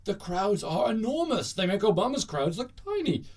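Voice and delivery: high-pitched, gushing mock brag; hammed-up bragging; mock boasting. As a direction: imitate an overblown boast. Mimicking Trump's crowd boast.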